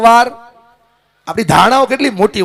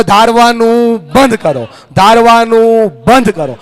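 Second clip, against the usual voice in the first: higher-pitched, drawn-out rallying call; loud call-out; 5 semitones higher, 7 dB louder, slower than usual. Shown as a demonstration. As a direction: lead the crowd in a slow, loud chant. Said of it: "જોરથી બોલો". (જોરથી, લંબાવીને બોલાવેલો નારો)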